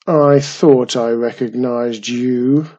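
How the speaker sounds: put-on gruff recognition from an old creature; grumpy goblin. Goblin speaks to Mum, grumpy goblin voice. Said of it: "he said to Mum".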